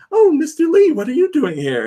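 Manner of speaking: put-on high, cheery old-lady greeting; sweet Aunt May. Aunt May greeting Mister Lee, high and cheery. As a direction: greet him brightly, oblivious. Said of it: "Aunt May's like".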